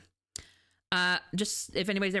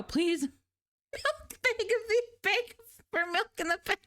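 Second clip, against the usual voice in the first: high, pleading, mock-old-fashioned voice; begging soup voice; 12 semitones higher, much slower than usual. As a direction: beg like a silly little soup. Voicing a soup begging a cow.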